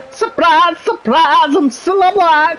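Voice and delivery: hammed-up game-show host in a Geordie lilt; Ant and Dec impression. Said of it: "the one that everyone was waiting from was".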